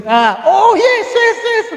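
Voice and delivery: high, drawn-out put-on "oh yes"; hammed-up faked party cheer, stretched and high; mock party guest. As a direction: ham up a fake party reveller, slow and high. Friends faking a party on camera.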